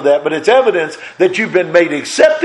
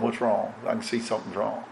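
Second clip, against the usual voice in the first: much softer, low, caring question; gentle concern; 6 semitones lower, 14 dB quieter than usual. Retold or imitated. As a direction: ask softly, as a caring friend. Church folk asking with concern.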